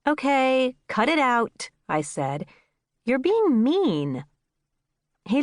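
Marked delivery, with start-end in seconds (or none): 0.1-1.7 higher-pitched mild telling-off; firm but mild reprimand; scolding. A narrator's reading. Scolding the laughing cat. 3.0-4.2 slow, higher-pitched reproach; scolding. A narrator's reading. Scolding the laughing cat.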